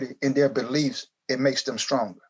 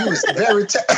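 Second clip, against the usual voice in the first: loud laugh breaking the words; laughing shout; 6 semitones higher, 4 dB louder than usual. Pretend. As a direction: burst out laughing mid-praise. Mock praise through laughter.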